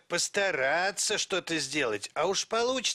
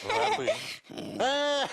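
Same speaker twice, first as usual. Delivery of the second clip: much higher, laughing realisation; embarrassed laugh; 9 semitones higher, slower than usual. Acted. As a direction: laugh at yourself. Notices his raised paws.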